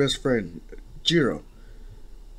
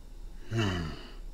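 soft drawn-out sigh of giving in; resigned sigh; 4 semitones lower, 9 dB quieter than usual. Acted. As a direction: sigh in defeat. Boss gives in, annoyed.

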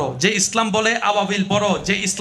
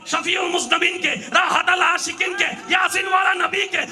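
full-force preaching; 11 semitones higher, slower than usual. Acted. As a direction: rouse the whole crowd at the top of your voice.

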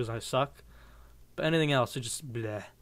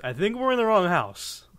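high, loud quote voice; exaggerated movie quote; 5 semitones higher, 5 dB louder than usual. Gamer redoing an accidentally used quote, hammed-up voice. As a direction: ham up the famous line. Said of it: "that's such a commonly used quote".

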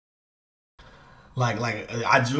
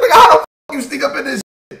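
fast, much higher, louder yell with laughter; retold yell; 12 semitones higher, 9 dB louder, much faster than usual. Retelling catching a guy sneaking into his scene, laughing yell. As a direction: yell it fast while cracking up. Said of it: "I said".

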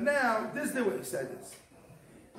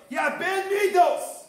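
raised voice, slowed, pressing the point home; emphatic shout; 8 semitones higher, 5 dB louder than usual. Acted. Teacher confronting students about bad traits, loud emphatic call. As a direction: call out the charge loud and slow.

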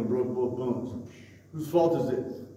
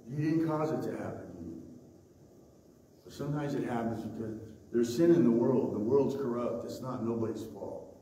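normal talking voice

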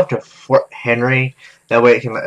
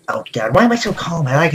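ordinary talk, nothing marked

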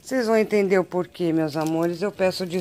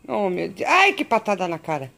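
ordinary delivery

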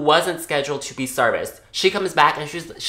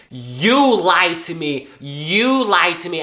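slow, louder accusing quote, higher; angry customer; 5 semitones higher, 4 dB louder, slower than usual. Retold or imitated. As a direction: retell her furious accusation, slowly. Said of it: "Like, she is pissed".

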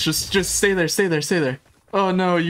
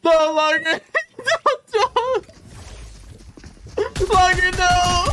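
laughing pleas; 12 semitones higher, slower than usual. Real, not acted. Streamer pleading with teammate Lager mid-fight, laughing pleas. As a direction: beg your teammate to stop, half laughing.

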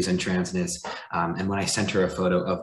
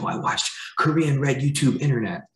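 put-on higher, lightly accented grandma voice; imitating grandmother; 8 semitones higher than usual. Grandson retelling grandma's explanation, lightly voiced grandma. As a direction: play the matter-of-fact grandma naming her source.

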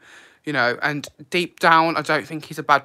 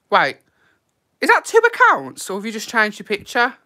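sudden high surprised squeal, louder; surprised question; 7 semitones higher, 7 dB louder than usual. Real, not acted. Noticing a viewer's second account, high surprised squeal. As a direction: jolt up in pitch, caught off guard.

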